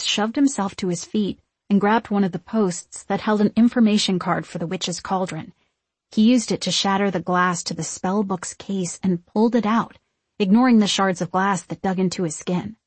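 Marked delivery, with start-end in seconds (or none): none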